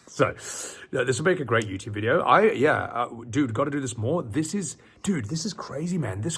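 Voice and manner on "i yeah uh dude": usual speaking voice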